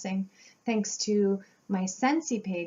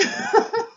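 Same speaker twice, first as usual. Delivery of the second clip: loud, high embarrassed laugh; high burst of laughter; 10 dB louder than usual. Real, not acted. Realizing her wrong guess, she bursts into a loud high laugh.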